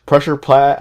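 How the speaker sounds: "Pressure pad" tripped over, pad coming out "plad"; mispronounced. Real, not acted. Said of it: "I can't even say it right".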